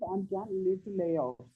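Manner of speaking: faint, far from the mic; barely audible. Real, not acted. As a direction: voice fading away from the mic. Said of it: "why your voice is very low".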